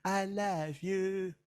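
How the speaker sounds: slow, mock-tender "I love you"; husband says it without meaning it; a hint of a hollow sweet-talker. The husband tells his wife he loves her, mock-tender and slow. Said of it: "biar ngomong".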